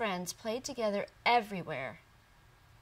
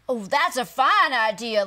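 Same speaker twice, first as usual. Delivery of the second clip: delighted frog; 2 semitones higher, 10 dB louder than usual. Acted. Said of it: "little frog said".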